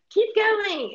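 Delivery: high, loud urgent cry; urgent keep going. Retold or imitated. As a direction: push each other on, breathless. Urging while running downhill.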